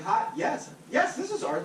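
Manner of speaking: sweet professional phone greeting; customer service voice. Retold or imitated. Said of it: "your customer service voice".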